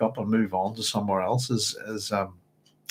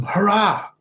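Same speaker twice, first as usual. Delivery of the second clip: sharp high shout of her name; Paul cutting Hera off with a shout; 10 semitones higher, 4 dB louder than usual. A narrator's reading. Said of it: "it just shouts her name".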